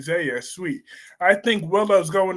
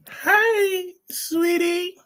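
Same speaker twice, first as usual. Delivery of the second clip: put-on cooing baby voice; 11 semitones higher, much slower than usual. Cooing at the child character.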